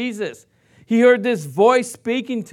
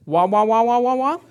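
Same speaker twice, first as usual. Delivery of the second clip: wordless wah wah of the cartoon teacher; Charlie Brown's teacher; 2 semitones higher than usual. Pastor mimics the cartoon teacher's droning wah wah. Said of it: "Charlie Brown when he's talking to his teacher".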